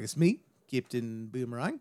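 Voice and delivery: "Captain Boomerang" with tight Aussie vowels, rising end; lilting put-on Australian; Australian accent. Shown as a demonstration. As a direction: introduce yourself as an Australian. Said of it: "get into an Australian accent".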